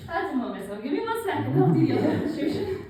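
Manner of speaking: panting hard between words; winded, gasping after the routine; out of breath. Real, not acted. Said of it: "I am out of breath".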